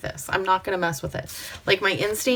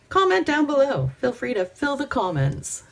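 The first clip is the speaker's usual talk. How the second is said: peppy call; 5 semitones higher, 4 dB louder than usual. Shown as a demonstration. Second choice, said brighter.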